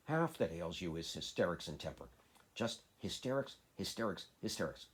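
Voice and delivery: hysterics hammered out three times; stamping each word. A narrator's reading. Mary stamps as she repeats hysterics at Colin. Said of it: "she stamped each time she said it".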